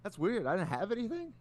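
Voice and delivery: high-pitched sarcastic question; mock puzzlement. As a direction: throw the accusation back, feigning surprise. Retold taunt back at sore loser.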